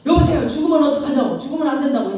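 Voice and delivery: high, loud retold alarm; acted panic. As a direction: cry out as if someone is dying. Acted panic as woman seems dead.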